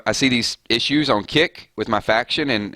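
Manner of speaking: usual speaking voice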